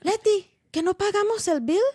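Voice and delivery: put-on loud Spanish-accented dad, laughing; Spanish accent, loud and baffled. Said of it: "Please say it in a Spanish accent".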